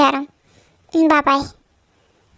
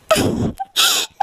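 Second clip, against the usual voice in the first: sobbing cry. Acted. Lisa sobbing after being slapped.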